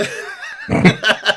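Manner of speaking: very high burst of laughter. Real, not acted. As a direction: crack up in a high-pitched laugh. Laughing at the players' dice and stats.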